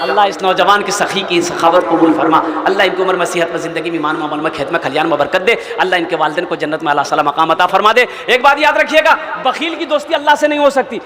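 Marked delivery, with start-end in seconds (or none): 0.0-8.1 low-pitched, rolling supplication; murmured-register dua, well below preaching pitch; prayer. Real, not acted. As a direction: recite a blessing low and steadily for the donor. Prays for the donor.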